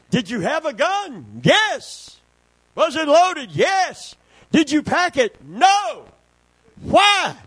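preacher calling out and answering his own questions; fiery preaching. Acted. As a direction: fire up the congregation with rapid questions and answers. Preaching at full force.

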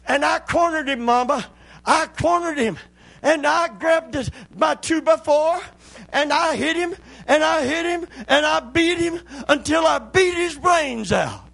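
put-on high, loud, breathless child yelling; excited little boy. Boy running in to tell his mama about the rat, shouting high. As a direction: burst in shouting like an eager kid. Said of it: "the little boy comes running in".

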